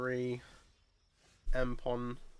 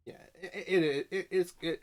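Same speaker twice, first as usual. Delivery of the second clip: laughing; 6 semitones higher, 5 dB louder than usual. Real, not acted. Stumbling, giggling over words.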